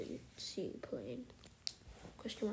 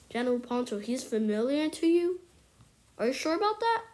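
ordinary delivery

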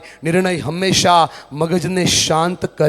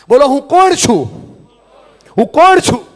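high, loud lead lines for echo; preacher leading a chant; call and response; 8 semitones higher, 11 dB louder than usual. Acted. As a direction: shout each question for the hall to repeat. Leads crowd in call and response.

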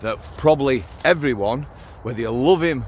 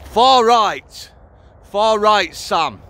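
Far right bellowed higher and louder, then slowed right down; mock accusing shout; 8 semitones higher, 6 dB louder, much slower than usual. Mocking the name people call him, loud and slow. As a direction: sneer the label out like an accuser.

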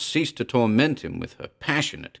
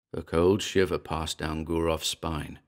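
ordinary delivery, nothing marked

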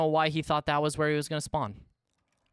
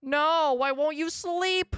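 loud, high, stretched-out despairing cry; high anguished wail; 4 dB louder, much slower than usual. Real, not acted. Gamer wails at a stubborn villager who won't sleep, high and loud.

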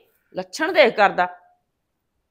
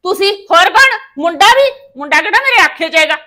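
higher and louder, furious scolding; angry shout; 6 semitones higher, 6 dB louder than usual. Acted. The mother-in-law shouts at her daughter-in-law in an angry shout. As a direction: shout back at her, raised and sharp.

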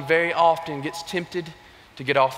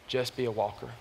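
low, soft and slow, a touch of tears; a hint of an old man welling up; choked-up old man; 6 semitones lower, 11 dB quieter, slower than usual. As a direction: hold back tears as you say it.

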